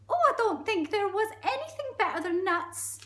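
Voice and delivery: very high, loud, inquisitive squeak; monkey voice. Acted. Monkey quizzing the crocodile, high squeaky voice. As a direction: pipe up like a nosy monkey.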